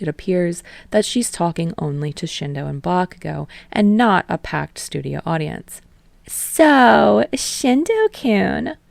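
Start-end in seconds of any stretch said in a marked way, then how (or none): 6.3-8.8 high, drawn-out sing-song opener; chirpy host. Acted. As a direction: lean in and coo his name. Host opens interview.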